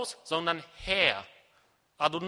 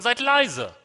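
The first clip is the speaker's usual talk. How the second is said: loud, higher command to a class; emphatic classroom order; raised teacher voice; 5 semitones higher, 7 dB louder than usual. Acted. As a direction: call the pupils to order forcefully. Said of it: "wird da vielleicht ein bisschen lauter reden".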